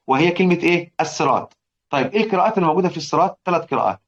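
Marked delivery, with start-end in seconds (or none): none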